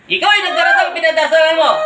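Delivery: demanding repeat with weight on yung. Real, not acted. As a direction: press the question again, harder. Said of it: "repeated question".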